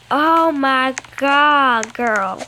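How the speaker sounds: slow, drawn out oh my god; slow stunned exclamation; shocked. Real, not acted. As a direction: stretch it out in disbelief. Shocked reaction while playing.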